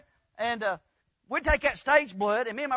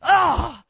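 short loud yelp as if hit; mock cry of pain; 5 semitones higher, 11 dB louder than usual. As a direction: yelp like you've been struck. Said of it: "and he'd go".

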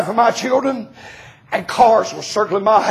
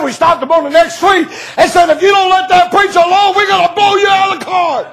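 shouted threat; 8 semitones higher than usual. Retold or imitated. Men warning off the attackers.

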